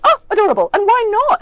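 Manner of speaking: very high, bright delighted squeal. Retold or imitated. Writer charmed by actors' German mice, delighted. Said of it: "I didn't mind, I was like".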